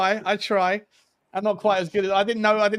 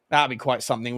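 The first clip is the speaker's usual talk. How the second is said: ordinary delivery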